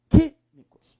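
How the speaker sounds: "Químicos" with QUI punched high and loud; stress on QUI. Shown as a demonstration. Teacher showing students the strongest syllable, stress on QUI. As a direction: hit the first syllable of "Químicos" hard. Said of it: "é o qui".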